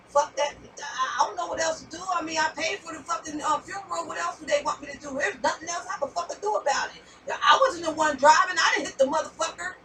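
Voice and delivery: grieving family's imagined version of the star's words, ranted; mock angry rant. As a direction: snap back like a fed-up, defensive star. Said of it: "They heard her saying it like".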